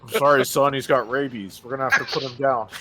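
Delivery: retold firefighter. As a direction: play the firefighter breaking bad news. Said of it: "And he's like".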